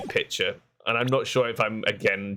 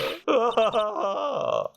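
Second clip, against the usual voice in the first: high, drawn-out acted laugh; character's boastful laugh; 11 semitones higher than usual. Head nun laughing after boasting to the court, high acted cackle. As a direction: laugh high and long, pleased with yourself.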